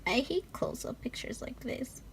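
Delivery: quiet overwhelmed whisper. Real, not acted. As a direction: whisper the mock complaint. Whispering over photos.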